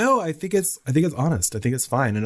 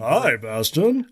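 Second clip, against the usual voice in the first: slow, higher put-on creature voice; creepy dragon greeting; 5 semitones higher, much slower than usual. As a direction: drag out the greeting in a mock-creepy voice. Voicing Falkor as creepy greeter.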